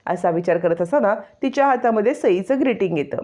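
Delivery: plain reading voice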